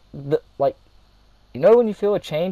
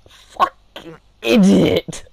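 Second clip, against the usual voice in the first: loud exasperated; 5 semitones higher, 8 dB louder, much slower than usual. Retold or imitated. Exasperated at friend.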